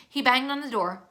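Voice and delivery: usual speaking voice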